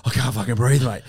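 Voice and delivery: mock breathless panic. Trainee pleading with the pilot from the back seat, mock breathless panic. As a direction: blurt it as if out of air. I was trying to fucking breathe.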